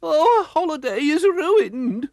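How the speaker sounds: high, loud, slow wail. Acted. Missus Bumblebee mourning the broken-down holiday, a high slow wail. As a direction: wail it as a distraught mother. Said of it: "wailed Missus Bumblebee".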